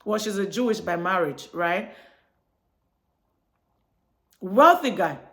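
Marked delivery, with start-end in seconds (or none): none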